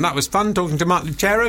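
ordinary talk, nothing marked

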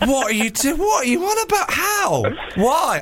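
high, disbelieving protest; can't believe what he's hearing; incredulous. Real, not acted. Host protesting the guest's film pick, high incredulous.

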